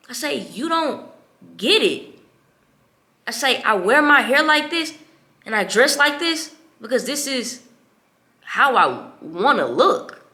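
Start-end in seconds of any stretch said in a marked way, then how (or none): 0.4-2.2 "You don't" high, then "get it" loud after a pause; retold exasperation. Daughter confronting her father, "You don't get it" broken up in exasperation. 3.6-10.2 loud, high, emphatic insistence; retold confrontation. Daughter explaining herself to her father, loud retold confrontation.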